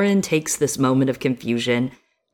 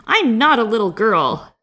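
put-on a raised, indignant little-girl yell; mild mock yell of an angry child; mock furious shout; 6 semitones higher, 4 dB louder than usual. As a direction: shout it back like an insulted kid. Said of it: "she shouts, furious".

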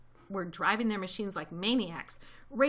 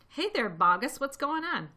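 raised, lilting call; 6 semitones higher, 4 dB louder than usual. A narrator's reading. Villagers at the crater's edge, calling down. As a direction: hail someone down in a pit.

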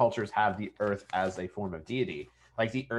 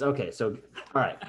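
usual speaking voice

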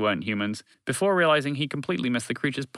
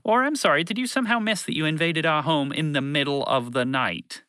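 patronising, raised-pitch question; condescending; 6 semitones higher than usual. Acted. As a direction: talk down with a sarcastic apology. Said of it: "responded condescendingly".

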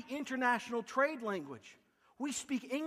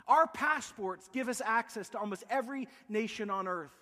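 normal talking voice

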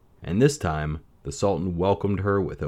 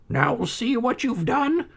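much higher, slow lament; moaning; slower than usual. A narrator's reading. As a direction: wail it as a despairing mother. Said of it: "his mother moaned".

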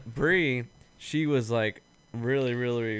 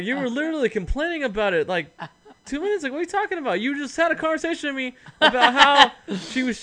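much higher, laughing in disbelief; 12 semitones higher, 5 dB louder than usual. Retold or imitated. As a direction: call her out, half laughing. Retelling his own disbelieving retort.

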